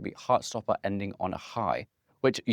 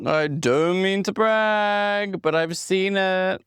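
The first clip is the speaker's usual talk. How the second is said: smug brag; 9 semitones higher, 5 dB louder, much slower than usual. Pretend. Smug teasing about seeing film.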